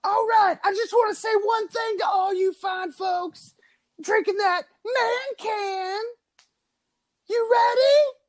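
[name] rock-star voice. Acted. Podcast host playing [name] for the crowd, hammed-up arena shout. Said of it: "Voice of [name]".

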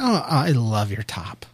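mock sweet brush-off. Pretend reply dodging the car question, slow soft brush-off. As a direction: say it sweetly dismissive. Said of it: "something boys don't say".

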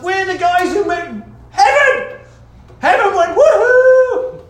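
high, loud celebratory whoop; hammed-up elated shout, climbing to a woohoo; jubilant shouting. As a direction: cheer like a whole crowd celebrating. Acts out shepherds and heaven celebrating.

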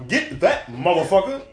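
mock Morgan Freeman yell; movie line yell. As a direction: shout it like a movie villain. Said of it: "when he was at the end of, uh, Wanted".

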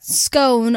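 SCONE rhyming with cone; long O. Shown as a demonstration. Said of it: "it's got cone in it".

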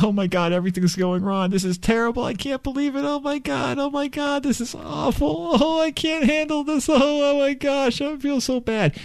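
very high-pitched, hammed-up wailing panic; melodramatic freak-out. Shown as a demonstration. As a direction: whip up a mock meltdown in a squealing high voice. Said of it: "freak out out loud to yourself".

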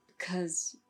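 soft, slow, whispered; hollow whisper. A narrator's reading. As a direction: breathe it out, numb. Kat's grim explanation.

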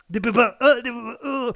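high loud squeal on pépins; uncle squealing. Acted. (tonton qui piaille, voix aiguë)

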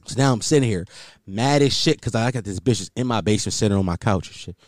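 ordinary talk

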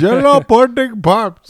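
put-on high, loud, slow Indian accent Cosby; Indian Bill Cosby impression. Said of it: "Indian Bill Cosby".

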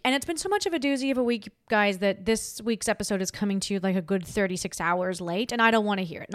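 normal talking voice

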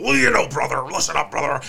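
wrestling promo swagger, lightly done; Hulk Hogan. Retold or imitated. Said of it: "Hogan's talking about".

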